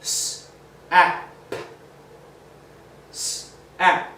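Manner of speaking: "s-, ap" with the "s" hissed apart; sounded out. Shown as a demonstration. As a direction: split "s-" from "ap". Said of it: "Just like in the word".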